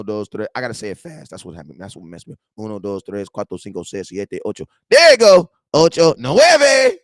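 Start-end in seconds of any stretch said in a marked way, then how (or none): 4.9-7.0 loud, high, drawn-out triumphant count; stretched, loud celebration. Real, not acted. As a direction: belt the numbers out like a victory.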